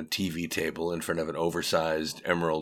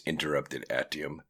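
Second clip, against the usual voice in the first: usual speaking voice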